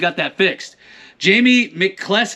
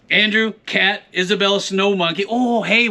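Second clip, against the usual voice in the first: normal talking voice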